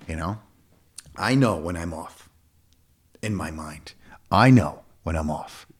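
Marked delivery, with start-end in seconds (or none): 4.3-5.7 loud punch on "I", then slow and lower; stress on I. Real, not acted. Coach insisting he knows himself, stressing "I". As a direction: hit "I" hard, drawn out. Emphatic repeat of own point.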